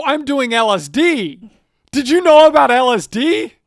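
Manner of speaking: acted wild-eyed drug enthusiast; manic raving. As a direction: rave it, climbing to a shout. Acting Poe raving about drugs.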